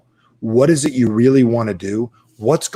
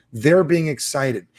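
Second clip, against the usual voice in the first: ordinary delivery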